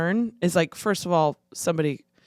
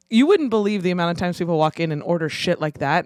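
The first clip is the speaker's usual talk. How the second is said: usual speaking voice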